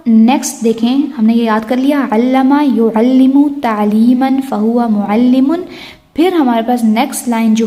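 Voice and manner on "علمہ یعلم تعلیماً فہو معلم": measured, deliberate Arabic recitation; slow drill recitation. Shown as a demonstration. Teacher models a verb family for students, slow recitation. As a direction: recite the word family slowly for memorizing.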